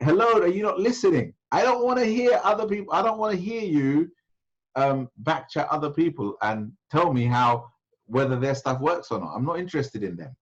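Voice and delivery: imitated exasperated scolding, pitched high; infuriated. Sheikh tells a man off for backchat, mock infuriated. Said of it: "getting more and more infuriated".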